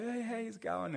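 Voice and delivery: high, loud mock hail; imitated stranger's greeting. Stranger greeting the surfers from his boat, high imitated hail. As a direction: call out a cheery greeting in a put-on high voice. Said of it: "in his American accent".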